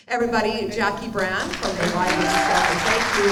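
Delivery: projected emcee call to the room; loud announcing. Real, not acted. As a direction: announce it loudly to the crowd. Host announcing guest to crowd.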